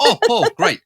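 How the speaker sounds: mock delighted old man; cheery greeting. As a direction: greet him like an old friend. Father-in-law greets officer cheerfully.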